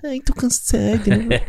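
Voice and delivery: high, drawn-out mocking whine; mock wimpy thirty-year-old. Host mocks a slacking thirty-year-old beside Tom Cruise, mocking whine. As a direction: put on a high, slow teasing voice. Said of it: "você tá com seus trinta e tu vai ficar ali".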